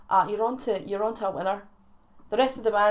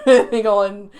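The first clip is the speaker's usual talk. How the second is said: laughing; 8 dB louder, slower than usual. Real, not acted.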